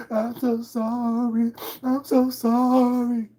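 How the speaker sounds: high, slow, hammed-up sobbing; mock sobbing apology. Mocking the apology made in tears, high and slow. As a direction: wail the apology like a fake crier. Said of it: "apologize in tears".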